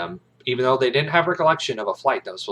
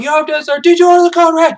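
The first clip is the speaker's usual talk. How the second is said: high, loud yelled demand; yelling; 13 dB louder, faster than usual. Retold or imitated. Kaffee yells at Jessup to answer, high and loud. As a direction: yell over him. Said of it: "they're all yelling".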